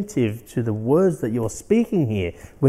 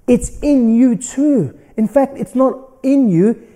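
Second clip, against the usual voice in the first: preaching; 7 semitones higher, 7 dB louder than usual. Acted. Fired-up sermon climax.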